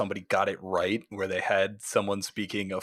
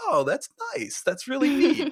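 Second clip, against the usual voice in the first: pleased viewer. Retold or imitated. Imitating pleased viewer reaction.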